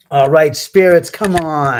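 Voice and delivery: loud summons to unseen spirits; calling out. Real, not acted. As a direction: call the spirits out loudly, impatiently.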